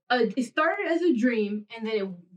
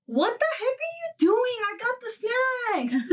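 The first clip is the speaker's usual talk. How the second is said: startled disbelief; 11 semitones higher, slower than usual. Retold or imitated. Reacting to niece running off.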